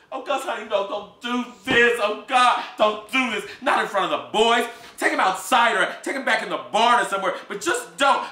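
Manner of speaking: high, anguished begging; begging him to stop; desperate pleading. Acted. Emma Jean pleading with Gus to spare the boy.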